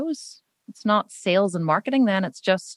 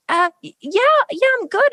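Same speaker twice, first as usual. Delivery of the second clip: high, louder awkward stammer; flustered cashier; 14 semitones higher, 4 dB louder than usual. Retold or imitated. Cashier caught off guard, high flustered stammer. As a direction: stumble out a surprised answer. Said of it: "she was like".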